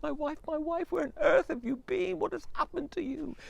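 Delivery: high, urgent questions; anxious husband. Acted. Husband asking his returned wife where she's been. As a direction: rush to her in alarm.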